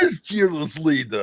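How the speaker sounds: put-on obedient Russian-accented reply; obey like a hammy cartoon spy; cartoon Russian henchman. Boris cartoon villain voice returns.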